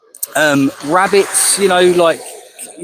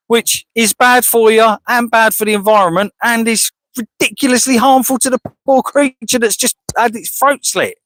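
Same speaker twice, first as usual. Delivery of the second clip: normal talking voice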